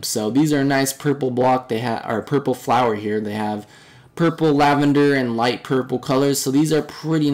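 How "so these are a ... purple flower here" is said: ordinary talk, nothing marked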